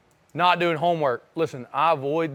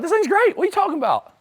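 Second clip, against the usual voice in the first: much higher, thrilled reenactment of my younger self; a teen defending his first car, thrilled; excited young me; 12 semitones higher than usual. Retold or imitated. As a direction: gush about the car like a kid. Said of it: "I was like".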